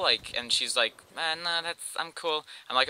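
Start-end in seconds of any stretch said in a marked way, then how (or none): 1.2-2.5 put-on higher, softer voice for the girl; lightly voiced girl, high and quiet; retold casual brush-off. Girl turning down an invite to bowl, casual high brush-off.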